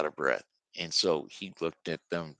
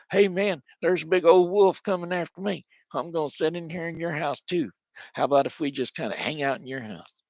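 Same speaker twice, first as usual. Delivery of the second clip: panicked little pig; 9 semitones higher than usual. Acted. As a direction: blurt it out breathless like a pig on the run. Fleeing pig panics to brother.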